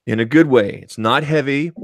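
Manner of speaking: ordinary delivery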